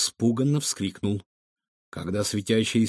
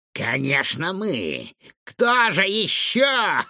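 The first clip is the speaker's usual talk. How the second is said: slow, stretched, rising into high glee; gloating mad doctor; 6 semitones higher, slower than usual. A narrator's reading. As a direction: crow it, building toward laughter. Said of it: "расхохотался доктор".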